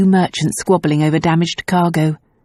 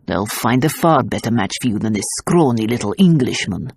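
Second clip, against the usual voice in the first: gruff; 6 semitones lower than usual. A narrator's reading. Gendarme ordering Tazia, gruff and low. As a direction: growl the order down at her.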